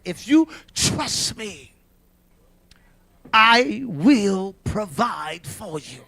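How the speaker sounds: slow, low voice of God; God's promise. Retold or imitated. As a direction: speak a solemn promise, word by word.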